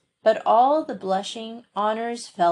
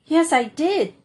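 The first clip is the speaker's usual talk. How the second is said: a high, louder, hesitant admission; faltering; 7 semitones higher, 4 dB louder than usual. A narrator's reading. Story Girl admits her mistake to Felicity, faltering. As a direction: caught out, voice wavering a little.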